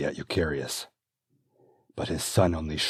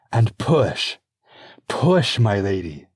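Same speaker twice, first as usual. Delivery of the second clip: higher, loud, firm urging; urgent birthing coach; coaching midwife; 5 semitones higher, 9 dB louder, slower than usual. Acted. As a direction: coach her through the pain. Midwife urges pushing.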